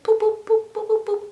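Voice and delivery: tiny high-pitched boops; imitated popping sounds. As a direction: pop out little high boops in quick bursts.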